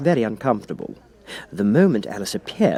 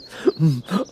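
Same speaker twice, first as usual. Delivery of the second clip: sly, amused cat laugh; the Cat laughs at the King; cat's chuckle; 6 semitones higher than usual. Acted. The Cat mocks the King, sly chuckle.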